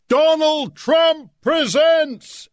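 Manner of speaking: high, slow, booming announcement; huge billing voice. Retold or imitated. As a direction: blare it out like a giant headline. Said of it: "in huge writing".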